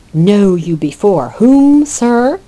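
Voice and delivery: drawing himself up to demand respect; uppity. Acted. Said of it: "Oliver again looking for respect".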